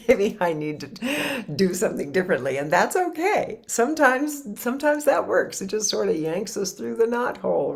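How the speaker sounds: high, loud, laughing through the words; tickled by your own example, voice jumping up high; amused laughing. Real, not acted. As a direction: let laughter lift the voice.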